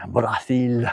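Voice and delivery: Brazil with a Spanish lisp, bra-THEEL; Spanish accent. Retold or imitated. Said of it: "Shouldn't be speaking in a Spanish accent about Brazil".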